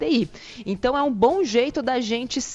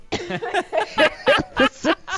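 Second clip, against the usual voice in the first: real laugh; 10 semitones higher than usual. Real, not acted. Laughs after mock prayer.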